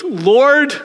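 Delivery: a mock exasperated call to God; exasperated plea. Ananias protesting to God, a high exasperated Lord. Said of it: "Ananias prays like we do".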